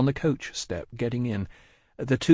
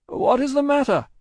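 high, raised questioning call; 5 dB louder than usual. Acted. Lorry asking Jerry through mist, raised call. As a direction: call the question to someone distant.

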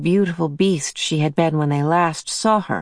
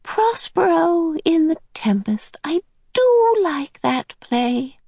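high breathy whisper; high whispered old lady; Mrs Who whisper; 12 semitones higher, slower than usual. A narrator's reading. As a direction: whisper it with fond delight, slowly.

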